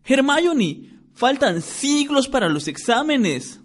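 a schoolboy whining at his friend; Ron's voice. A narrator's reading. Ron voiced, narrator reads story.

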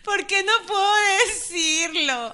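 helpless laughter, high and loud; half sobbing with laughter; laughing and crying. Real, not acted. Laughing fit over a blooper.

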